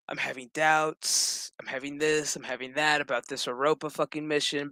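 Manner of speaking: low, soft recited list of worries; retold doubting. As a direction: lightly mimic a downbeat confession, low and quiet. Voicing a doubting therapy patient.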